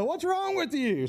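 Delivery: high, slow mock disbelief; drawn-out scornful exasperation, acted; making fun. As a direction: taunt someone for crying. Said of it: "they're gonna make fun of you".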